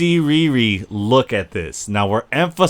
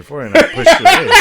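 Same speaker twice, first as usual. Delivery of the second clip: laughing while quoting; 5 dB louder, faster than usual. Retold or imitated. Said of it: "She goes".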